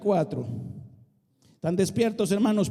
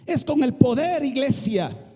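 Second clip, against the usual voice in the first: higher and louder declaration; emphatic shout; 5 semitones higher, 4 dB louder than usual. Real, not acted. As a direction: proclaim the power of God. Preaching emphasis.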